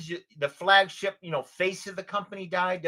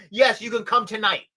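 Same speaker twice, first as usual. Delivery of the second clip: higher, louder mock insistence; insistent client; 5 semitones higher, 6 dB louder than usual. Client summons adviser on Sunday night, insistent. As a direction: imitate a client who won't be put off.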